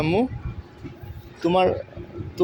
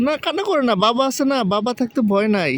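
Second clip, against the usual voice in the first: calm her down gently, pitched up; soothing; 7 semitones higher than usual. Real, not acted. Father soothing his upset little daughter, high and gentle.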